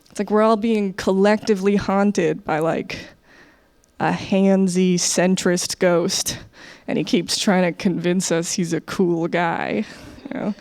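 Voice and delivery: usual speaking voice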